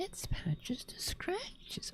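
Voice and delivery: put-on soft high baby-talk whisper; high cooing whisper. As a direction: coo it softly up high. Repeating cat's title higher.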